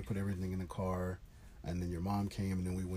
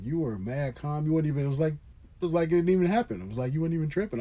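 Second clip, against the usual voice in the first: amazed; 7 semitones higher, 7 dB louder than usual. Real, not acted. Amazed at how calm she was.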